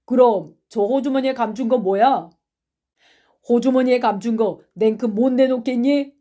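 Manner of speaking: loud accusing questions, building to an order; demanding. Acted. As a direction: press hard for surrender. Accusers demand he empty pocket.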